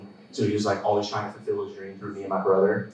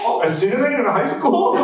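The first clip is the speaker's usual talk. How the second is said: high-pitched, louder mock panic; incredulous, raised-voice question; alarmed disbelief; 11 semitones higher, 5 dB louder than usual. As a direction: squeal it as a stunned kid. Acts out shocked younger self.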